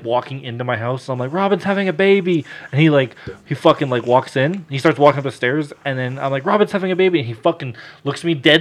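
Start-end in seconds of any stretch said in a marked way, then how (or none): 1.4-2.5 high-pitched, mildly frantic news-breaking; retold urgent announcement. Retold announcement to dad's friend. 6.5-7.2 high-pitched, mildly frantic news-breaking; retold urgent announcement. Same retold announcement repeated.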